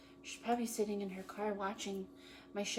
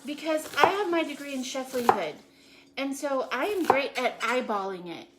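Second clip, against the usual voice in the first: higher and louder hammed-up self-praise; mock bragging; 5 semitones higher, 6 dB louder than usual. Boasting to producer Patrick about perfect chopping, hammed-up brag. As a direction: show off like a mock-proud chef.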